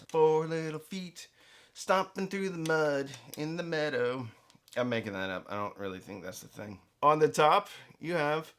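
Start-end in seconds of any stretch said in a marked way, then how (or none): 0.1-4.3 lightly sing-song made-up rhyme, slowed and a bit higher; nursery rhyme. Pretend. Reviewer making up a rhyme about the feet, light sing-song. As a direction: recite it like a little children's verse. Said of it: "like that's like a nursery rhyme".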